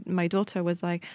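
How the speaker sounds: normal talking voice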